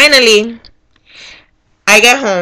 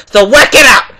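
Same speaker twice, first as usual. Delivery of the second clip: louder, fed-up order; demanding; 2 semitones higher, 5 dB louder than usual. Retold or imitated. Voter ordering the worker to fix it, demanding. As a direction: lay down the order forcefully.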